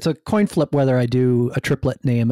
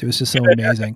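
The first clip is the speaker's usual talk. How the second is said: smiling voice; faster than usual. Real, not acted. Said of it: "I can hear the smile in your voice".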